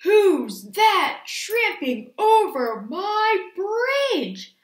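very slow, loud, high-swooping roar; troll roar. A narrator's reading. Troll challenging the biggest goat, long loud roar. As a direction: roar and stretch every word out. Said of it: "roared the troll".

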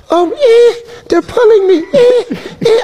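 high whimpering put-on voice; mock whining. Retelling fans pulling at Mike, imitates his high whining. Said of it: "Shut up all that whining".

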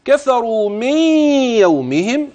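slow high chanted verse; measured tajweed chant; recitation. Shown as a demonstration. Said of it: "خلي بالك".

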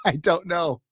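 giggling through the words; laughing. Real, not acted. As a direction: laugh it out, barely getting words past the giggles. Laughing at viewer's joke.

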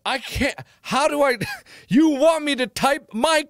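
high, loud flustered outburst; exasperated yell. Real, not acted. Streamer sputters at the app about his keyboard, loud and high. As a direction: sputter at the screen, fed up.

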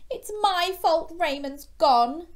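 high, loud upset snap; guilty young shark snapping at his mum; snapped. A narrator's reading. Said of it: "Gilbert snapped".

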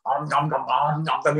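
mock gobbling; imitated mouth-stuffing chomps; noisy pretend eating, om-nom style; greedy munching noises. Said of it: "eating as many as he can".

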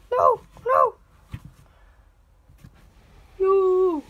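loud high pleading no; desperate protest. Pretend. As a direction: cry out as you are caught. Captured figure protests.

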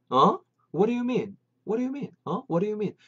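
high-pitched, baffled asking; acted puzzled question. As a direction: ask back in puzzled surprise. Dialogue reply, puzzled.

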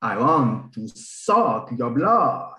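slow low vampire. Pretend. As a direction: do a hammy vampire. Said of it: "someone do a vampire".

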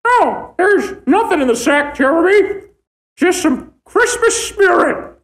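high-pitched talking-sack character; put-on sack voice. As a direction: voice the sack in a high, silly register. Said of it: "Change my voice to, like, something high".

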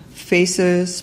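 normal talking voice

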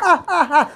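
mock laugh. Mock laugh after quote.

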